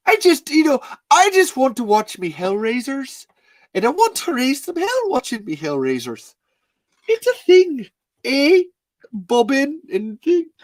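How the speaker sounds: put-on very high, loud Irish accent; hammed-up Irish impression; Cillian Murphy. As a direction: push the Irish accent way up in pitch. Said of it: "you're playing Cillian Murphy".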